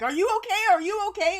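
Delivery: high, loud anxious asking; frantic friends checking in; high-pitched alarmed concern; worried friends. Retold or imitated. Frantic friends messaging her.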